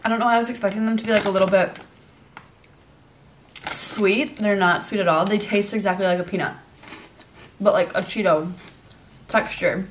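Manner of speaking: speaking between bites; talking while chewing. Real, not acted. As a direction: mouth partly full of snack. Seen 0: Eats puffs before; mouth chewing while talking, bag in hand.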